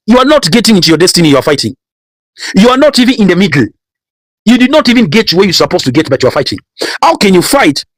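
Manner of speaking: high, rising sermon rebuke; preaching at full force. Acted. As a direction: push every line up like a fiery preacher.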